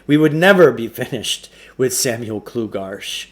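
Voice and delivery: plain reading voice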